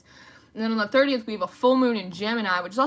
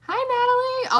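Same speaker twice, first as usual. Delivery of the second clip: much higher and louder hello; excited greeting; 6 dB louder than usual. Real, not acted. Welcoming a new viewer to her live stream, thrilled and high. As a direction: squeal the name with delight.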